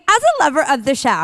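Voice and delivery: put-on bubbly Valley Girl lilt. Shown as a demonstration. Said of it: "I go right into Valley Girl voice".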